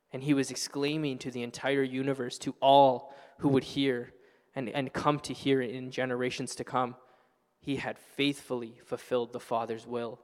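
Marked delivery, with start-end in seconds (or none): none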